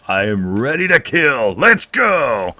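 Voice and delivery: slow then rising to a high, loud yell; mock battle cry. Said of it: "I'm like".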